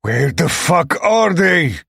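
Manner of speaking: loud, slow, furious; raging demand. A narrator's reading. Lex raging at the missing friends, loud and slow. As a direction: rage at the empty cabin. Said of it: "Lex rages".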